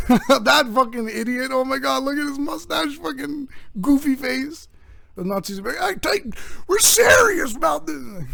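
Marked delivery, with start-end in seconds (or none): 0.0-4.7 mocking laughter. People seeing Hitler for the first time, high mocking laughter. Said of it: "that they laughed". 5.8-8.2 high, indignant mock Southern accent. Nazis objecting to being laughed at, high comic Southern accent. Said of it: "do a Southern accent".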